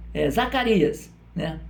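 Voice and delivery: ordinary delivery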